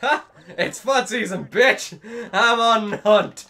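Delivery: high, loud battle cry; gloating shout. Pretend. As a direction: yell it as a taunt. Gloating while hunting in game.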